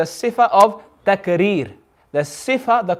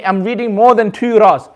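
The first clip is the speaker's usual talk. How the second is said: usual speaking voice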